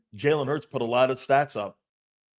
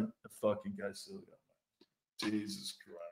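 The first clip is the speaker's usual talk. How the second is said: very soft, lower grumbling quote; muttered grumble; 6 semitones lower, 16 dB quieter than usual. Retold or imitated. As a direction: mutter it like a bitter fan. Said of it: "He's like".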